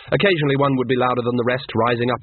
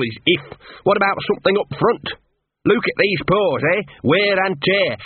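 higher-pitched mongrel voice, grumbling and wheedling; put-on dog character, protesting at the terms; talking dog, haggling; 4 semitones higher than usual. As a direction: play a small dog angling for payment up front.

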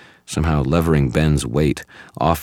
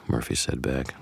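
plain reading voice

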